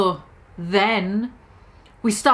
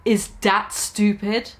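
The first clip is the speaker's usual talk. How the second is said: ordinary talk, nothing marked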